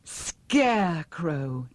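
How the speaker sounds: drawn-out happy call of his name; overjoyed reunion cry; joyful cry. Acted. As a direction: stretch the name out in joy. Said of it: "Dorothy cried for joy".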